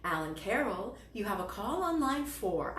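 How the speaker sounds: normal talking voice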